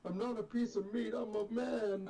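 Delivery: high-pitched put-on voice; mock protest. Player clowning as someone insisting he's a man, mock protest. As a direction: plead in a high, silly voice.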